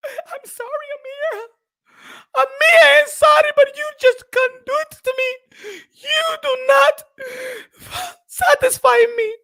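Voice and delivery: high, whimpering wife voice; hammed-up sobbing apology; mock crying wife. Wife apologising to Amir, in a high, hammed-up crying voice.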